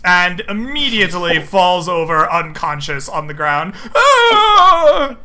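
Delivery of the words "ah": high, loud mock wail, half laughing; hammed-up cry. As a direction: cry out as she drops. Enemy falls unconscious.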